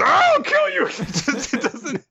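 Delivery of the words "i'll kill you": very high, loud comic threat; squeaky threat. Retold or imitated. Dora threatening Dosan, very high squeaky comic threat.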